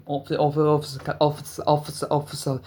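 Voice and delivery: stuck repeating Officer; stammering over Officer; stutter. Real, not acted. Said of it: "bad stutter".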